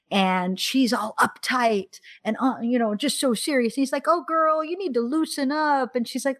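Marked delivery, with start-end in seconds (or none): 4.1-6.0 higher-pitched, teasing quote of the hero; loosen-her-up banter, pitched up; playful film hero. Acted. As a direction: lightly act out a coaxing suitor. Voices film hero teasing heroine.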